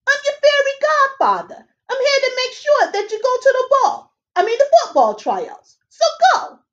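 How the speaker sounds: high, chirpy little fairy man; fairy godfather. Acted. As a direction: squeak it out like a tiny busy fairy. Fairy godfather introduces himself.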